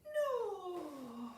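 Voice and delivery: breathy, drawn-out sighing fall; Sarah Lancashire. Acted. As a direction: let it glide softly down on the breath. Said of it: "very breathy".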